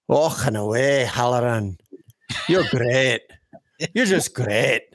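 lightly put-on Indian accent, half laughing. Shown as a demonstration. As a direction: try out an Indian accent on a name and a compliment. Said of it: "That was my Indian accent".

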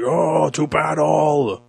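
mock heroic knight. Voicing a sword-wielding Transformer.

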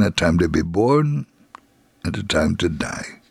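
ordinary talk

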